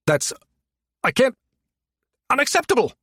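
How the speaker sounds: high-pitched indignant spluttering. A narrator's reading. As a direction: splutter in broken bursts, pitch shooting up. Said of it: "Stinton spluttered".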